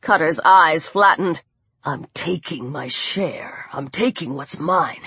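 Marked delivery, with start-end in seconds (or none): 1.9-5.0 low, quiet, deliberate voice; hushed thug's growing threat; menacing attacker. A narrator's reading. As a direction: answer deep and soft, unbudging. Attacker defends his theft.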